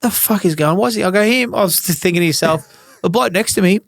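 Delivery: high-pitched, aggrieved retold complaint; retold near-tears protest. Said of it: "walk out there nearly in tears".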